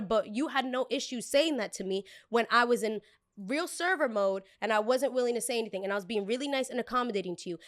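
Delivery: ordinary talk, nothing marked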